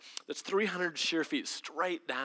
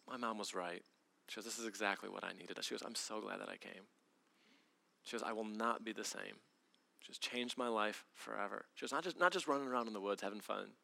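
soft heartfelt; 5 semitones lower, 6 dB quieter than usual. Retold or imitated. Girl tells how trip changed her.

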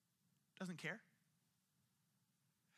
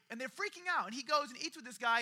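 ordinary talk, nothing marked

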